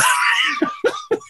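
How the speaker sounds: laughing hard in a very high burst; high squealing laugh. Real, not acted. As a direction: break into a high cackle. Laughing at the memory.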